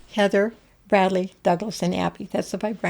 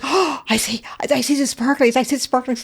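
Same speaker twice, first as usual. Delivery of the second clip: higher-pitched, thrilled discovery; retold gasp of delighted excitement; excited child; 5 semitones higher than usual. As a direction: imitate an excited kid spotting something magical. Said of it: "she's all excited".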